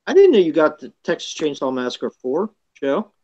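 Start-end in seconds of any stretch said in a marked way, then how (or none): none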